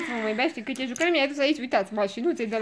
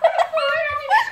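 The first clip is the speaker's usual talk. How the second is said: very high, loud dog call; excited pet call; 9 dB louder than usual. Real, not acted. Calling the puppy over, excited high call. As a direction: squeal the puppy's name to bring it over.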